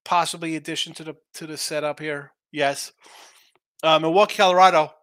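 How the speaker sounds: ordinary talk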